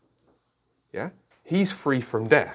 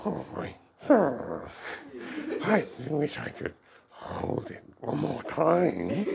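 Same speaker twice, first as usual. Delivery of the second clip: put-on slow, hushed, wistful old hobbit; longing Bilbo; 5 dB quieter, slower than usual. Teacher quoting Bilbo to class, slow and wistful. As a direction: linger over it like an old man missing a treasure. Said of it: "And he says".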